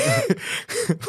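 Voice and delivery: real laugh breaking out high; high burst of laughter. Real, not acted. Friend cracking up while retelling the tour prank, real laughter. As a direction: let a squeaky laugh burst out.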